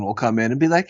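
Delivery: ordinary talk, nothing marked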